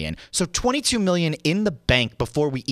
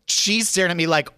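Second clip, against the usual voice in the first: ordinary delivery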